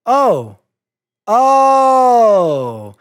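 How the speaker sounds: rising alarmed oh, held long; startled gasp of realisation; shocked oh. Acted. Shocked reaction to invoice.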